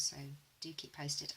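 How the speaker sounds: ordinary talk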